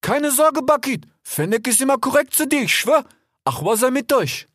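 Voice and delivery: high voice calling after the flyer. A narrator's reading. Fennec calling after the departing vulture, reassuring call. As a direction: shout reassurance after him. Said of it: "Fennec rief ihm nach".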